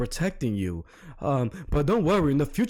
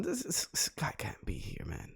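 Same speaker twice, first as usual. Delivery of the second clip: whispered aside; 2 semitones lower, 13 dB quieter than usual. Real, not acted. Quiet disbelieving aside.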